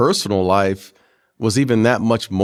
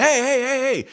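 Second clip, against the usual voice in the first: high-pitched string of heys; mock high "hold on" call; imitated interruption. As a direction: pitch it way up to cut in on the celebration. Friend cuts short the celebration.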